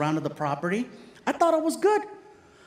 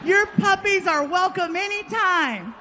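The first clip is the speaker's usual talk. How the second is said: chirpy barista; 11 semitones higher than usual. Retold or imitated. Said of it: "Can you say that louder?".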